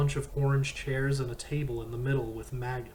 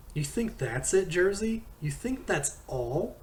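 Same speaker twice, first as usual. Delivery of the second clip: angry; 6 semitones higher than usual. Acted. Todd confronting Jersey, furious.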